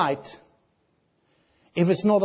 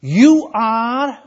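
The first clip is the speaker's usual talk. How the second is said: "You are" drawn out loud, each word apart; emphatic You; 3 semitones higher, 5 dB louder than usual. Shown as a demonstration. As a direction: land hard on "You", then hold "are". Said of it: "is emphatic".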